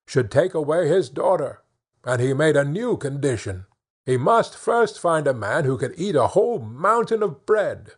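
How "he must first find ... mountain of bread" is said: ordinary delivery, nothing marked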